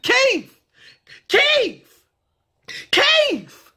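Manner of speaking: high-pitched, drawn-out call. Acted. Calling a friend over, high holler. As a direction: holler "Keith" as "Keef", high.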